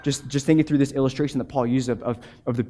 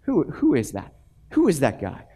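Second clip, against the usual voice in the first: a touch softer, shrugging repetition; lightly voiced calm indifference; unfazed pastor; 3 dB quieter than usual. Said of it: "And he goes".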